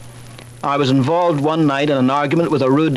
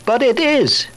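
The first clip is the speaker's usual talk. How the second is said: put-on higher, insistent little girl's "But it is"; daughter insisting; 9 semitones higher, slower than usual. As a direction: stubbornly insist like a small daughter. Daughter insisting in children's quarrel.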